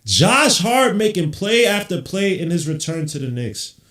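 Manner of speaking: loud, high and slow, every word leaned on; emphatic praise. Real, not acted. Streamer hailing Josh Hart's return, slow loud call. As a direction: proclaim it like a highlight call.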